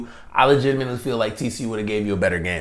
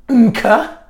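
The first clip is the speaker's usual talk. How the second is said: laughing; 10 semitones higher, 5 dB louder than usual. Pretend. Mocking the botched acronym.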